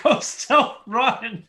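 drawn-out high mock line; high, very slow sing-song. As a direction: stretch out Ghosts don't run high and slow. Repeated joke line.

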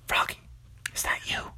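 soft unvoiced whisper. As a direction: whisper so the thieves won't wake. Captive whispers in hideout.

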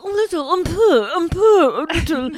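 high, loud showy un peu; child showing off French; precious little girl. Retold or imitated. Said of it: "she's like".

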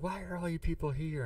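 high-pitched mock yell at the door; mock yelling wife. The suspect's wife yelling at the film crew, high mock yell. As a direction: pitch up as the wife shouting them off her lawn. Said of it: "starts yelling at him".

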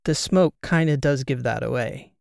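plain reading voice

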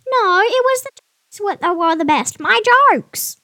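high, loud squawky parrot. Acted. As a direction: interrupt like an indignant parrot.

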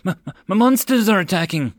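high, frightened stutter; stammering. Acted. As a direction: stumble on the first word in panic. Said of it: "the wild-eyed soldier stammered".

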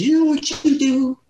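hint of a kindly elder giving advice; Martin Luther King advice. Martin Luther King encouraging a young singer, lightly voiced kindly elder. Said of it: "He said".

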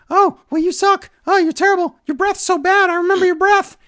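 high, loud mocking whine; hammed-up high taunting voice; mocking Jerry Lawler. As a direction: jeer like a heckling commentator. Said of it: "And he's like".

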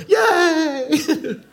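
put-on very high, drawn-out child's cheer; delighted little girl. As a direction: squeal with delight, imitating a small girl. Imitates small daughter's happy reply.

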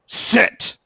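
high, loud stern command; firm scolding order to the dog; upset voice. Acted. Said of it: "I use my upset voice".

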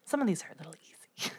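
fast whispered aside. Real, not acted. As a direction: whisper it quickly under your breath.

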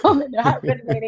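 giggling as you tell it; laughing. Real, not acted. Laughing while retelling nerves.

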